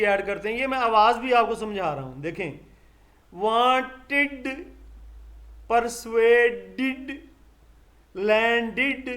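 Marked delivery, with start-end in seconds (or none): 3.3-7.1 वांटेड and परसुएडेड very slow, ending clearly on id. Shown as a demonstration. Teacher drills the id ending for students, slow and clear. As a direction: stretch each word so the final id is clear. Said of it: "ये इड की आवाज़ आ रही है".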